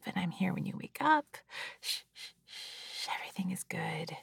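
hushed reassuring whisper; calm them down to sleep; soothing whisper. Pretend. Lulling a baby to sleep.

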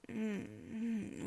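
normal talking voice

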